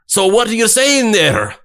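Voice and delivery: high, loud Scottish question; incredulous Scottish accent. Retold or imitated. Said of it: "incredulous Scottish man".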